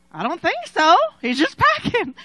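brightly brushing off the worry; excited reassurance. Retold or imitated. Wife telling husband the man is only packing, amused. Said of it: "I said".

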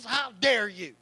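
ordinary delivery

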